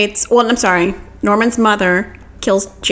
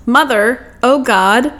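retold cry of horror, slightly raised; mock yell; 4 semitones higher, much slower than usual. Retelling Norman's yell from the house, mock horrified cry. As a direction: imitate his horrified yell without full volume. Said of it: "and he yells".

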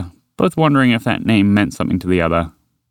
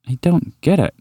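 higher, puzzled tone; bewildered wondering; 7 semitones higher than usual. Acted. Walter feeling completely lost, bewildered wondering. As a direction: sound completely lost, thinking aloud. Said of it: "Walter wondered aloud".

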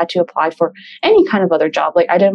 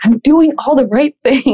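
mock earnest; 7 semitones higher than usual. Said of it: "in quotations".

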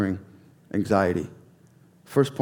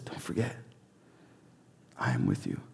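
gentle reassurance; 12 semitones lower, 13 dB quieter, faster than usual. Retold or imitated. God reassuring the afraid.

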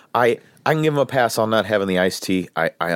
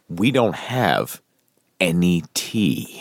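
slow correction; 4 semitones lower, much slower than usual. Retold or imitated. Correcting the quote verbatim.

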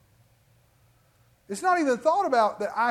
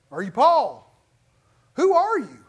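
louder, rising challenge; loud retort; 5 semitones higher, 10 dB louder than usual. Retold or imitated. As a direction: call him out loudly. Womack retorting to a critic.

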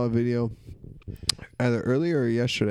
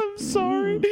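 very high, squeaky with laughter; mock apology, barely holding it together; helpless laughing apology. Repeating driver's apology through laughter.

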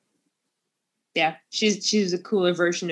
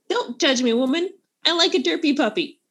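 mock defensive; 6 semitones higher, 3 dB louder than usual. Mock defensive retort to friend.

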